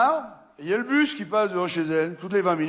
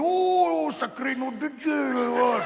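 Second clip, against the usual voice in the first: amazed farmer; 8 semitones higher, slower than usual. Acted.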